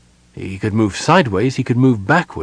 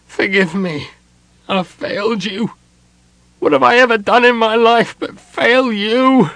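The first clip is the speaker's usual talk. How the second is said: high anguished plea, swelling to a loud cry; agony; 12 semitones higher, 5 dB louder than usual. A narrator's reading. Son confesses failure to his mother, in agony. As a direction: beg forgiveness, breaking into an anguished outcry. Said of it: "he said in agony".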